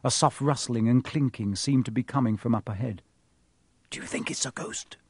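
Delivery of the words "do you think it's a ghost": whisper. Acted. Whispering in dark passage.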